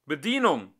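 loud call for the waiter across the room. A narrator's reading. Old man calls the waiter from the corner, loud call. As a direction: call the waitress loudly. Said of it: "rief der ältere Mann".

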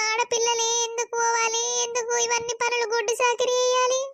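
high, pleading lament to Shiva; a weeping complaint, pitched high; tearful lament. Acted. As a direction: cry out to god about women's lot.

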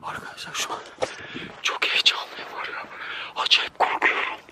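tense, hushed whisper. Real, not acted. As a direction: whisper without a sound. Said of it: "Ses etmemem lazım". (fısıltıyla)

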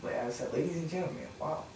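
very soft, low comment; quiet, low sarcastic aside; muttered wry aside. Real, not acted. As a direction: say it well under your breath. Wry aside during a scolding.

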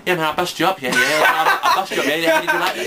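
in your face. Pretend. Gloating over a beaten opponent, mock taunt. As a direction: rub the win in their face. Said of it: "I'll be like in your face".

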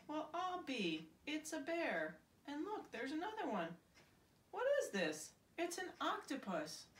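rising astonishment at each new find; surprised. Acted. Mister Pine finds the lost toys in the scrapyard, amazed.

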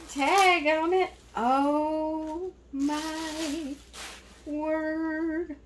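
high, drawn-out astonishment; amazed. Real, not acted. Gift opener spotting the Carter's tag, amazed. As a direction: stretch out each word in high-pitched wonder.

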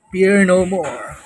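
ordinary talk

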